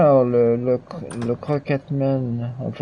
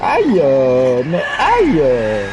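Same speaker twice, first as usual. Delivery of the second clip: protesting ouch; 5 dB louder, much slower than usual. Real, not acted. Gamer protesting as his character gets hurt, loud yelping complaint. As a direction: complain with drawn-out yelps at each hit. Player getting hit in game.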